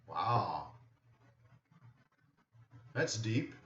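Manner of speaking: ordinary talk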